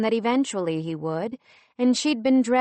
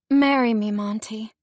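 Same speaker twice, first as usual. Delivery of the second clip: louder and higher, a sudden plea; pleading; 3 semitones higher, 7 dB louder than usual. A narrator's reading. Lindsay begging Monty to marry her, a loud high plea. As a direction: blurt out a plea. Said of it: "she pleaded".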